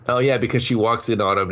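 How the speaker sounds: ordinary talk, nothing marked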